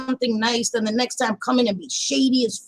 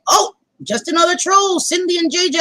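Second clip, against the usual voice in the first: higher-pitched retold exclamation; mock exclamation; 6 semitones higher than usual. Streamer she was watching calls out Cindy and J J, mock exclamation. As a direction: imitate her pitched-up announcement. Mimicking streamer naming them as trolls.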